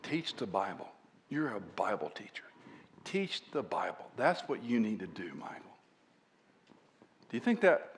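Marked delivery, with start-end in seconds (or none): 0.0-5.7 soft, low, ending in a whisper; soft urging. Retold or imitated. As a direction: press the point gently, fading to a whisper. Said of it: "And then he would say".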